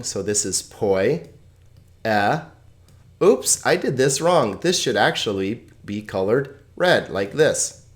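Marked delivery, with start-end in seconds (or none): 0.6-2.4 poi as one glide, then a separate e; syllable by syllable. Shown as a demonstration. Teacher explaining the diphthong, poi and e said apart. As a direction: hold poi together, pause, then say e. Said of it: "Notice that oi is a diphthong".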